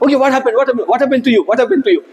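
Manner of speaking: mock worried parent asking what happened. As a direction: parent hearing a child chant affirmations aloud, mildly alarmed. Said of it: "your parents will like".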